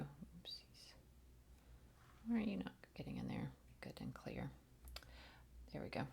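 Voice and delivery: very soft, half-whispered aside; muttering to herself. Real, not acted. Host fussing with cards that won't go in, muttering to herself. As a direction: murmur it under your breath.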